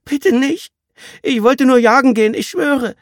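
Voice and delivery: put-on high, loud desperate begging boy; panicked pleading. Captured boy begs the gang in panic, high and loud. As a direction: beg for your life in a panic. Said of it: "Das Flehen des Jungen wurde panisch".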